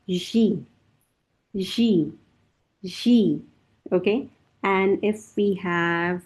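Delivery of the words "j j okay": ordinary talk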